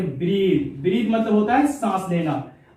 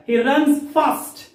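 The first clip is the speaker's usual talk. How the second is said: very slow, higher "he runs fast"; slow demo; 4 semitones higher, much slower than usual. Shown as a demonstration. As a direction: spell it out word by word for learners. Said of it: "runs बोलना है". (बहुत धीरे, हर शब्द अलग बोलना)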